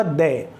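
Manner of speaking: "दे" as the weak form of "the"; "दे", not dee; pre-consonant "the". Shown as a demonstration. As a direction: say "दे" with a short neutral vowel. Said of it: "उसका pronunciation होगा".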